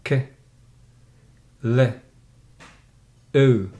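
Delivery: "K", "Le", "O" as separate sounds with long pauses. Shown as a demonstration. Teacher shows pupil the word, isolated sounds "K", "Le", "O". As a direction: break the word up: "K", then "Le", then "O". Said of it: "how this word is pronounced".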